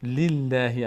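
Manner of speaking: لله drawn out with a light ll and fronted ae; thin, light lam. Shown as a demonstration. Teacher showing lillah is read thin, light drawn-out lam.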